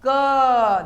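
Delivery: क said loud, half क then अ; k plus a. Shown as a demonstration. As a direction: split क into consonant and vowel. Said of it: "देखिए आधा क और अ". (क को आधा क और अ करके)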